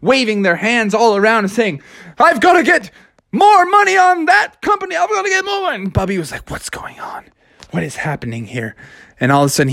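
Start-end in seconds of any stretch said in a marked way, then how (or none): 2.2-5.8 high-pitched stock floor clamour; frantic trader. Acted. Traders waving and shouting orders. 6.5-8.8 hushed, puzzled bear; bewildered hush. Acted. Bewildered bear amid the crowd.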